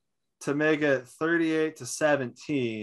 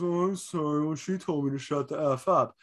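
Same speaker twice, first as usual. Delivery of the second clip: mock apology. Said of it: "And he just goes".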